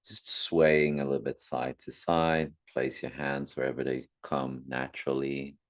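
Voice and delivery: calm meditation guide with long pauses; unhurried meditation voice, phrase by phrase; guided meditation. Acted. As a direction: gently guide listeners inward, leaving long silences.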